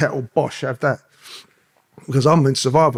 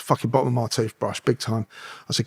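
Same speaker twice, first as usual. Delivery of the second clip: ordinary talk, nothing marked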